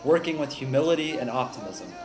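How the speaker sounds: calm, earnest narration over music; reflective voiceover. Acted. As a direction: read it like a heartfelt documentary narration.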